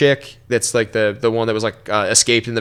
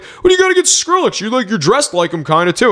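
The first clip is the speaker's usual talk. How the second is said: very high, whiny imitation of the coworker; mock pestering; 10 semitones higher than usual. Coworker says he dresses like [name], mockingly pestering. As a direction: needle him in a squeaky put-on voice.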